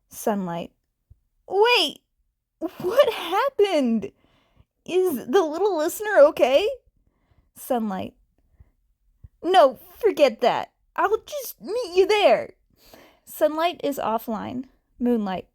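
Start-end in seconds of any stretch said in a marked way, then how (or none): 1.5-6.7 high, loud alarmed cry; panicked friend. Acted. Friend panics over text. 9.4-12.6 urgent friend. Acted. Friend decides to come.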